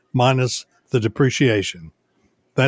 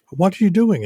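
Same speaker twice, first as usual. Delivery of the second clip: put-on higher voice for the lady; homeowner association lady; 8 semitones higher than usual. Association lady questioning the man with the antenna, higher mimicked voice. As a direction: imitate the lady, pitch jumping up. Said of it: "when it's the lady from the H O A".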